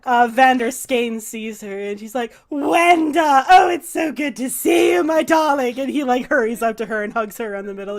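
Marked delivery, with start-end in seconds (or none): 2.5-5.8 higher, louder gushing welcome; effusive shout. Acted. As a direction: call out to an old friend with delight. Vander greets Wenda.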